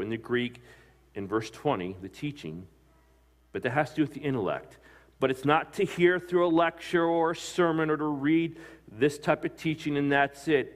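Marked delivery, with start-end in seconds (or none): 5.2-10.7 higher and louder, driving the point home; preaching at full force. Acted. Preacher raising his voice.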